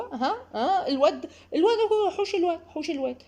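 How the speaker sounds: high, laughing mock panic; panicked grandmother. Grandmother panicking that the boy will break things, high mock panic. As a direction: shriek at the mother to grab the boy, hammed-up. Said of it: "حماتك او مامتك".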